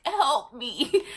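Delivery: loud, high plea through laughter; laughing plea. Retold or imitated. Daughter calling her mom for help, laughing. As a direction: beg for help while cracking up.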